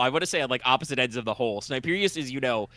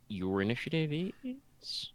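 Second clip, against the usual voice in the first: slow whispered aside; whispering; 3 semitones higher, 10 dB quieter, slower than usual. Real, not acted. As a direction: trail off into a whisper. GM aside while rolling.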